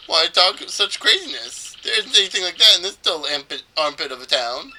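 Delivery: lightly hammed-up scoffing; dismissive trader character. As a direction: scoff theatrically, ending on a high "no".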